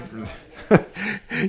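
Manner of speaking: a sudden high burst of laughter. Real, not acted. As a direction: crack up at the chat's joke. Reading chat, bursts out laughing.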